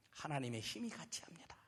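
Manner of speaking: hushed, reverent whisper; preacher whispering the miracle moment. Acted. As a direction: breathe the line in awe. Climax of the stone.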